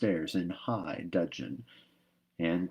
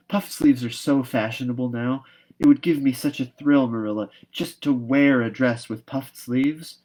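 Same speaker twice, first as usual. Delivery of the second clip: put-on higher, louder, longing girl's plea; eager, pleading Anne; 5 semitones higher, 6 dB louder than usual. Anne begging Marilla for puffed sleeves, eager and higher. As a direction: beg sweetly for the puffed sleeves.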